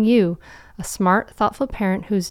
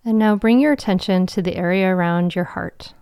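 guided meditation. Acted. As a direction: guide them gently into calm.

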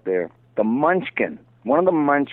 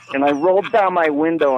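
ordinary talk, nothing marked

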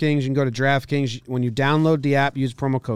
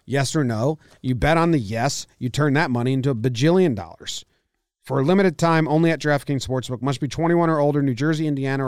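ad read; 3 semitones higher, 3 dB louder than usual. Acted. Sponsor ad read.

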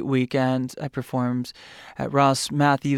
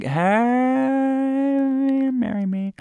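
flirty; 11 semitones higher, much slower than usual. Retold or imitated. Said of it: "I'm like".

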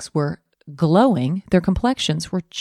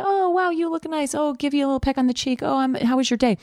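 high, sweet, fond greeting; doting spouse; 6 semitones higher than usual. Retold or imitated. Spouse greeting a partner home from work, high and doting. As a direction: gush like a newlywed spouse. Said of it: "your spouse used to look up and say".

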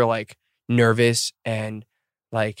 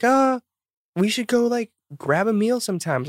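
lightly voiced high invitation; high imitation voice; 10 semitones higher than usual. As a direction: say it shy and high. Retold invitation from group.

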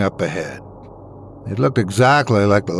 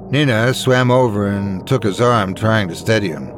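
ordinary delivery

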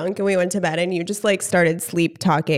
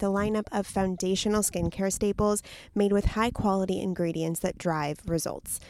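soft, polished ad read; 9 dB quieter than usual. Acted. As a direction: sell it gently like a commercial. Sponsor ad read.

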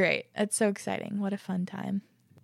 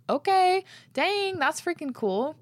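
excited; 9 semitones higher, 4 dB louder, slower than usual. Real, not acted. Reacting to dad's pizza recipes.

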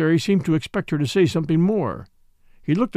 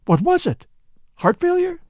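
high, rising shocked questions; startled questioning; 13 semitones higher than usual. A narrator's reading. Catherine to the commissary about the death, startled questioning. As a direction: press for the cause, voice climbing.

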